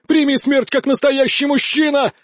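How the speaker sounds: high, indignant call; frustrated shout. A narrator's reading. Duelist challenging the man he chases, shouting. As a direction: cry out a challenge to the runaway. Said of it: "закричал он".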